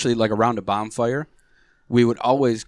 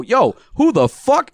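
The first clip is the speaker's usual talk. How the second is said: high, drawn-out challenge, much higher and louder; mock hostile yell; 12 semitones higher, 3 dB louder, slower than usual. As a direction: call out a stranger sharply and slowly. Confronting strangers in backyard.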